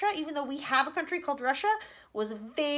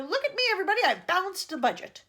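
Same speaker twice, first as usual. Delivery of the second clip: loud, high proud boast; 8 semitones higher, 6 dB louder than usual. Acted. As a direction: show off to everyone. Advisor boasting to the room.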